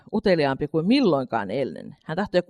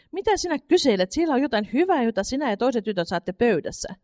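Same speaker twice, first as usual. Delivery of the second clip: mother answering; 6 semitones higher than usual. A narrator's reading.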